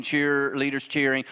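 usual speaking voice

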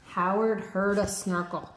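ordinary delivery, nothing marked

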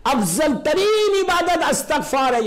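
high, slow and loud declaration; preaching emphasis. Acted. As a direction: hammer the lesson home. Preacher drives home point.

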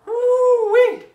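loud, high "woo-wee" cheer; excited whoop. Real, not acted. Cheer after finishing the exercise.

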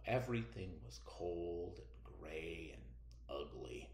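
hushed whisper, dropping away. A narrator's reading. Teacher reads the bleak opening to the class in a whisper. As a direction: breathe it out like a secret.

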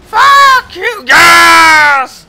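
very high, full-volume screamed curse; triumphant screaming. Real, not acted. Gamer cursing opponent after a kill, triumphant screaming. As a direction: scream it out in victory.

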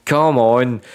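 loud, high burst of real joy as the bonus lands; excited shout. Real, not acted. Player shouting at the slot screen, genuinely thrilled. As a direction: yell it out, thrilled and disbelieving.

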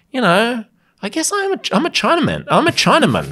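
high, pensive mock self-questioning; Michael Hing. Retelling a comic's bit about himself, mock pensive. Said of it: "asking himself pensively".

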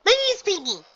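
very high, loud bubbly pony voice; Pinky, exclaiming. Acted. Pinky introduces herself to the gym leader, very high and bubbly. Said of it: "Pinky exclaimed".

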